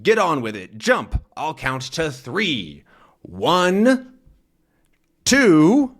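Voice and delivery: menacing villain. Acted. Man ordering Tintin to jump, slow building count. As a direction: count down the threat. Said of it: "and he says".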